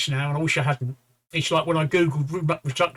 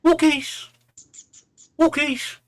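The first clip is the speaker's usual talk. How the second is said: high, bright sing-song call; calling the dog; 12 semitones higher, 4 dB louder, slower than usual. Acted. Man teases the dog with a high walkies call. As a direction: lure the dog with a cheerful call.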